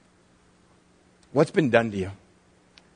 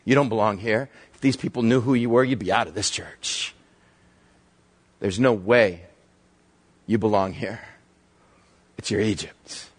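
accusing whisper; 8 semitones lower, 4 dB quieter than usual. Acted. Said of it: "That constant whisper".

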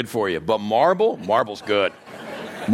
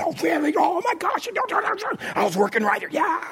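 high, hammed-up gossip gasp; mock surprise; 13 semitones higher than usual. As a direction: play the shocked coworker, high up. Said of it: "You go".

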